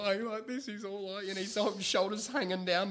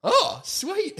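high, louder oh sweet; mock glee, much higher; delighted; 5 dB louder than usual. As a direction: sound thrilled at the chance. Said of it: "And he went".

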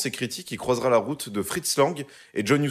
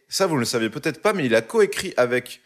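normal talking voice

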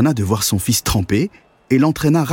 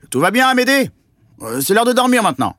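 calling father; 11 semitones higher, faster than usual. A narrator's reading. Father calls from kitchen.

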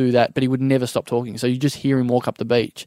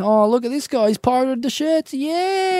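high, put-on exclamation rising higher; mock outrage; 9 semitones higher, slower than usual. Joker staging a pirated shirt photo, high mock outrage. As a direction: playact shock with a drawn-out yeah.

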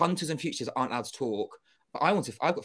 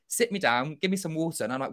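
ordinary talk, nothing marked

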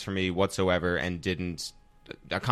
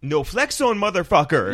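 much higher mock yell, a bit louder; retold scream; 8 semitones higher, 4 dB louder than usual. Kid at a Paris rap show yelling out of nowhere, mock yell. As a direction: holler it like a kid at a concert. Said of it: "screams out".

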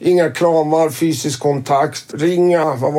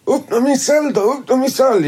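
usual speaking voice

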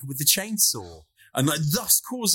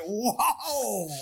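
mock astonishment; 8 semitones higher than usual. Reacting to the destroyed bathroom.

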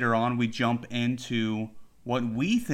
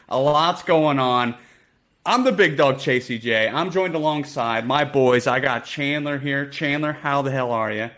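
podcast host intro; 5 dB louder than usual. Real, not acted.

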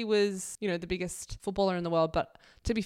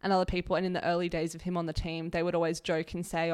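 usual speaking voice

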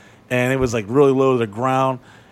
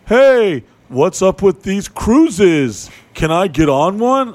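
much louder, higher, slow hammed-up showman greeting; over-the-top friend; 5 semitones higher, 6 dB louder, slower than usual. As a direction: ham it up like a loud party crasher. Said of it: "over the top".

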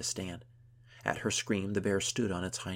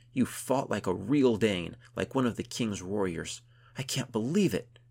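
excited, breathless praise; 2 semitones higher, 7 dB louder than usual. A narrator's reading. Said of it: "She was running out of breath".

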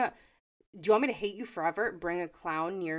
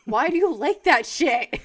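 loud, higher incredulous demand; exasperated outburst; 4 semitones higher, 10 dB louder than usual. Real, not acted. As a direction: burst out in baffled exasperation. Exasperated at people who like scares.

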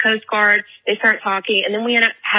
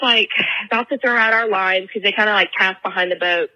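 ordinary talk, nothing marked

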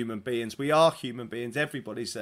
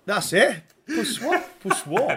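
higher, louder hammed-up French on Pour Soi; mock French accent; 9 semitones higher, 7 dB louder than usual. Shown as a demonstration. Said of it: "That's better".

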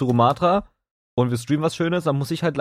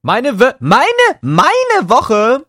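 mock disbelief; 13 semitones higher, 8 dB louder, much slower than usual. Asked about his week, mock disbelief.

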